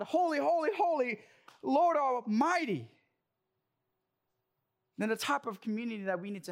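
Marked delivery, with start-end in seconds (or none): 0.0-2.9 proclaiming praise. Acted. Declaring God's holiness in worship.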